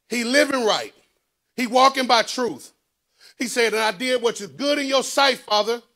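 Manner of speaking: high, loud sermon delivery; full-force preaching. Acted. Preacher telling the church of Hezekiah's prayer, full-force preaching.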